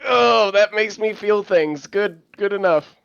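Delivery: high, drawn-out exclamation easing off; mock gushing reaction. Acting out judging art in a meeting, mock gushing. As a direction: overplay being moved, then settle for it.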